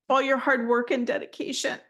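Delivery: ordinary talk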